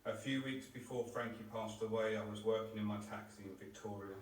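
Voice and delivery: normal talking voice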